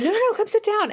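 insistent invitation. Acted. Coworker insists she sit down.

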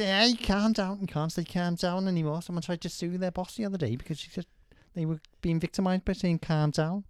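put-on sexy Scouser; Scouse accent. Shown as a demonstration. Trying the accent she wants to learn, sexy Scouse accent. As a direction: launch into a lively Scouse accent. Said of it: "That's actually not bad".

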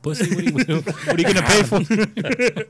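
laughing. Real, not acted. Friend mocking paying for neutering.

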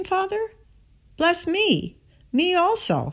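high, pleading plea to a father; a son begging for his blessing, voice lifted high; pleading cry. A narrator's reading. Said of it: "Esau lifted up his voice and wept".

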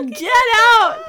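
loud, high outburst; exasperated shout. Real, not acted. Co-host heckles the doctor hogging the toilet, exasperated shout. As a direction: shout it in mock outrage. Listener reacts in disbelief to story.

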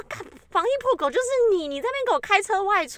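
high, fast mock scolding. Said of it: "我就说". (高声责骂)

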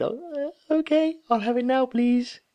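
put-on high, meek little kid voice; small, high-pitched, reluctant child; little school kid. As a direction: give in like a timid schoolchild. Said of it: "like a little school kid".

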